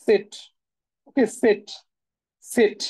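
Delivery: সেট spoken slowly as a model sound; German letter name. Shown as a demonstration. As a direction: repeat সেট for the class to copy. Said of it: "জেড এর যে উচ্চারণ সেটা হচ্ছে সেট". (ক্লাসকে শেখাতে স্পষ্ট করে সেট)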